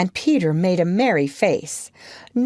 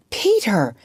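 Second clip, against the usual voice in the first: mildly excited character cry; a touch of breathless wonder; thrilled cry; 3 semitones higher than usual. A narrator's reading. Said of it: "she cried, clutching him".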